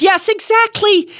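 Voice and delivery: high, gleeful mock shriek; delighted shriek. A narrator's reading. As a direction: imitate a mother shrieking to her friends on the phone. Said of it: "she would shriek".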